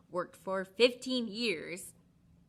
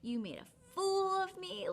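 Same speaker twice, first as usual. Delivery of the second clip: high, hurt accusation fading to a whisper; angry; 10 semitones higher than usual. Retold or imitated. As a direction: scold him, wounded. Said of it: "very angry".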